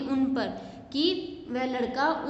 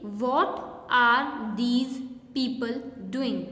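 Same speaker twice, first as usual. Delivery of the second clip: slow reading aloud; much slower than usual. Shown as a demonstration. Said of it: "teacher reads sentence aloud".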